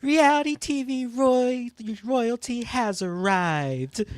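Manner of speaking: high, dramatic reality star voice; Rachel Riley impression. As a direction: belt it out like a TV diva. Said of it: "I don't even gotta play the clip anymore".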